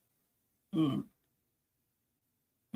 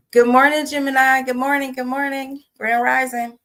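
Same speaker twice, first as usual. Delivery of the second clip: bright greeting; 7 semitones higher, 4 dB louder than usual. Real, not acted. Cheerful on-air greeting, higher.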